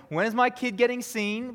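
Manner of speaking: high, insistent but polite asking; politely badgering. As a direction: press the nurse while staying courteous. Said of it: "badger the emergency nurse politely".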